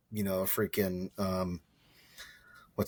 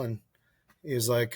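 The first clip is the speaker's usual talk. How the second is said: usual speaking voice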